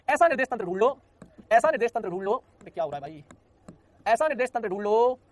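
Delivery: each ऐसा hit loud with a rising lift; loud, insistent classroom emphasis; emphatic repetition. Shown as a demonstration. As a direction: drill ऐसा निर्देश तंत्र ढूंढ लो home, loud and insistent. Teacher stresses the definition's wording.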